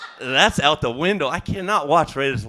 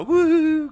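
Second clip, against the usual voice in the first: high hammed-up falsetto whoop of a sob; mock cry wail; 6 dB louder than usual. Shown as a demonstration. Comic demo of how to react to a sad note, a high fake wail. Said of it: "sad note means you're supposed to go".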